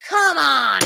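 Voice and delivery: high, drawn-out cry of frustration; exasperated. Real, not acted. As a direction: cry out like a fed-up fan. Fan fuming at his team's error.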